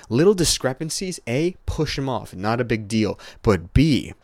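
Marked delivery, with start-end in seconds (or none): none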